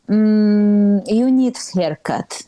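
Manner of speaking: put-on mild Eastern European accent, critical; lightly imitating a critical Eastern European mother; Eastern European mom. Comic telling of mom's haircut jab, mild Eastern European accent. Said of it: "We're just Eastern European. That's the accent".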